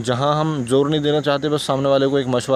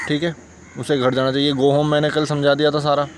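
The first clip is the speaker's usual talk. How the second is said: ordinary delivery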